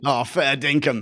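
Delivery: amused put-on Aussie; light mock-Australian reaction; Australian accent. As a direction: chuckle through broad Australian. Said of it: "does the best Australian accent".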